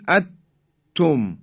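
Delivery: اتم with the n swallowed into a doubled t; no n at all in اتم, a stopped double t; idgham, wrong reading. Shown as a demonstration. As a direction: say اتم high and loud, holding the t closure. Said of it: "حالت ادغامه و صحیح نیست".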